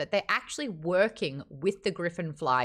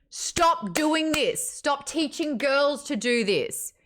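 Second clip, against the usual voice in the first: high, loud, slow pleading rant; slow exasperated outburst; 7 semitones higher, 3 dB louder, slower than usual. Real, not acted. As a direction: beg the readers to stop, drawn out. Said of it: "rant over".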